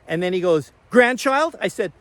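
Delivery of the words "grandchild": high, loud mock disbelief; imitated heckler, pitch leaping up; incredulous question. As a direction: throw the word back as a sharp rising question. Retold heckler's incredulous follow-up question.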